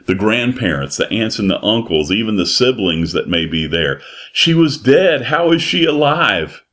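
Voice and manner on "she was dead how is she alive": high, loud shocked disbelief; astonished relatives. Acted. Preacher voicing shocked relatives, high astonished cry. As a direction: cry out like a stunned grandmother.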